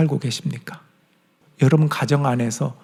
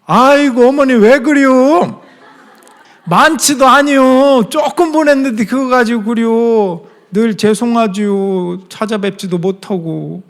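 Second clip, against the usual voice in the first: higher, louder, slow Chungcheong drawl; Chungcheong dialect; 7 semitones higher, 5 dB louder, slower than usual. Retold or imitated. Son answering mother on the phone in Chungcheong dialect, higher and slow. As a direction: humbly protest to mother in thick dialect. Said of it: "제가 그때부터 충청도 사투리가".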